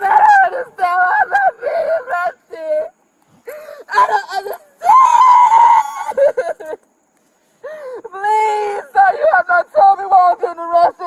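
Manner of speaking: loud high sobbing wail; inconsolable weeping. Real, not acted. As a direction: cry out in despair. Woman wailing during arrest.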